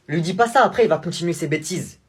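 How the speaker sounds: loud, sharp interruption; angry mother. Acted. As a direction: cut her off, annoyed. Mother objects loudly. (mère agacée qui coupe)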